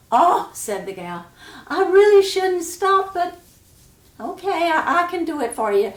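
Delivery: high, loud and flustered, then quick; a flustered girl who says yes anyway. Acted. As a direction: squeal it out, then rush to agree.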